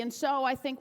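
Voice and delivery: ordinary talk, nothing marked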